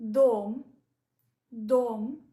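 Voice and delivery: dom said loud twice, stressed round o; teacher calling out dom; loud demo word. Shown as a demonstration. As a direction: call out dom so the clear o rings. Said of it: "O is stressed".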